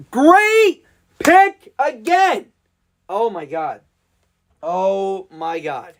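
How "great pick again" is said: ecstatic cheer. Real, not acted. Celebrating the pick.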